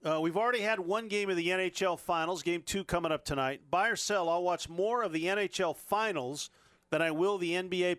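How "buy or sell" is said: usual speaking voice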